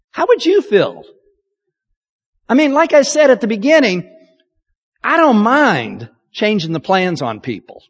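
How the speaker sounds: ordinary delivery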